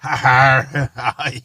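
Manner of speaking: loud laughing pirate aye. Pretend. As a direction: shout it like a pirate. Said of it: "in a pirate voice".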